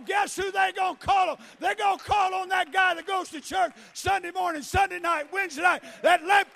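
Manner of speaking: loud, driving sermon delivery; full-force preaching. Real, not acted. Preacher telling church who the dying will call, full-force preaching. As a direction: shout it out to stir the congregation.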